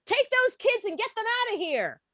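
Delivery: high-pitched, urgent mock order; fed-up wife. Wife on a Zoom call to husband, high exasperated order. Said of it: "and said to him".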